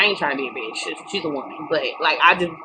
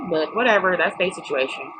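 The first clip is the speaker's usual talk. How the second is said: ordinary talk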